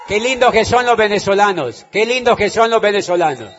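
loud, jubilant cry to the crowd; shouted praise. Real, not acted. Greeting the Venezuelan audience with a loud joyful shout. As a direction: shout it out with joy.

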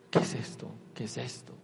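unvoiced, low, scared whisper; breathless frightened whisper. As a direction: whisper it as panic rises. Frightened inner question.

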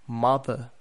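"mother" the British way, silent final R, falling; British demo of "mother" with a closing fall; British accent. Shown as a demonstration. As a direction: model British "mother", ending in an open uh. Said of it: "and then in the British".

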